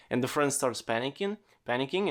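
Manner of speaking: normal talking voice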